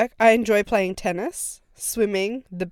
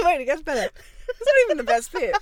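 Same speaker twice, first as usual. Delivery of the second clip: laughing; 7 semitones higher, faster than usual. Real, not acted. Laughing while reading.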